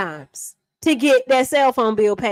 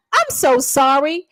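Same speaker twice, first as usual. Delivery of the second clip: usual speaking voice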